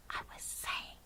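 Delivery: tiny, voiceless whisper; very soft. A narrator's reading. Sophie answers the giant in his ear, very softly. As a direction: whisper each word carefully. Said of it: "She spoke so softly".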